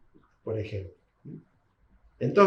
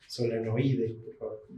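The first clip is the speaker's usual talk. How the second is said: ordinary delivery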